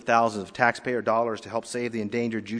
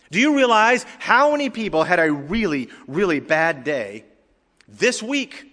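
raised, emphatic preaching with weighty pauses; 8 semitones higher, 7 dB louder than usual. Real, not acted. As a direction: press the challenge on the congregation.

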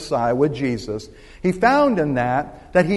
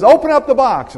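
lightly acted magician, louder and higher; magician's command; 8 semitones higher, 7 dB louder than usual. Magician to boy on stage, acted loud command.

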